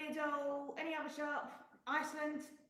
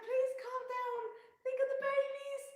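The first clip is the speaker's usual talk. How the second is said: much higher, pleading imitation of herself; 12 semitones higher than usual. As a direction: beg them high-pitched to calm down. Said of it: "I was going".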